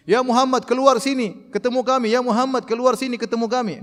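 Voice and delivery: imitating rude Bedouins yelling; rude calling out. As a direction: shout at the house to come out. Bedouins calling the Prophet rudely.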